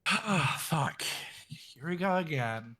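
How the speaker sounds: weary, here-it-comes sigh; exasperated sighing mutter to oneself; resigned sigh. Acted. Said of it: "like, sigh".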